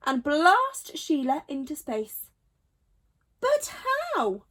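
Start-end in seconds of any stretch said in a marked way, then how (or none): 3.4-4.4 high puzzled animal voice. A narrator's reading. Animal asks.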